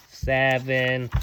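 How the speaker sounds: usual speaking voice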